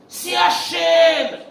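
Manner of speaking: loud, slow, drawn-out shout; shouted proclamation. Real, not acted. Rabbi shouting at the congregation, drawn-out proclamation. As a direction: proclaim it at full force.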